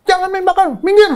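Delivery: high loud yell to clear the way; retold shout. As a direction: bark at them to move. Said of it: "saya bilang". (teriak menyuruh minggir)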